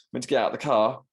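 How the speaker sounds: ordinary talk, nothing marked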